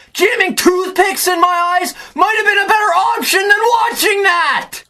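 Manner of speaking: high, strained fan rant; worked-up, high-pitched outburst; outraged yell. Real, not acted. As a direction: yell it in exasperated disbelief. Outraged hyperbole about watching game.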